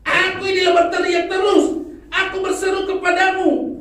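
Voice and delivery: high, loud shouted complaint; loud, high, indignant prophet; Habakuk's shouted complaint. Retold or imitated. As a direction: shout your grievance up to God. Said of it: "Itu Nabi Habakuk ngomong".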